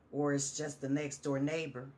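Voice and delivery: ordinary delivery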